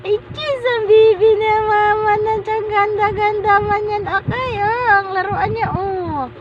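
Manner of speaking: put-on high, doting coo to a baby; baby talk. Parent admiring baby's toys, high baby talk.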